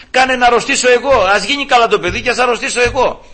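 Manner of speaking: high, urgent re-enacted prayer; desperate plea. Grandfather begging God for the child's illness, desperate pleading.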